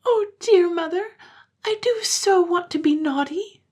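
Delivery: put-on high, tearful child's plea; sobbing. Child confessing to mother through tears, high and tearful. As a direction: plead through sobs. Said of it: "she sobbed".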